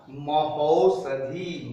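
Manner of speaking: महौषधि drawn out very slowly; slow demonstration. Shown as a demonstration. Teacher shows students the sandhi word महौषधि slowly. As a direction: stretch महौषधि syllable by syllable. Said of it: "नियम कहता है कि दोनों के स्थान पर औ हो जाएगा".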